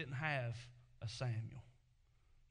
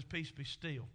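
calm command; 2 semitones lower, 3 dB quieter than usual. Acted. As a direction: still the sea with a quiet command. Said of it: "looks at the water and he says".

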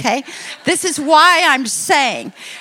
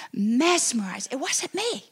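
ordinary talk, nothing marked

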